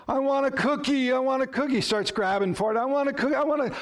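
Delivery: put-on high childish whine for a cookie; whiny toddler. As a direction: beg like a three-year-old. Preacher voices whining child.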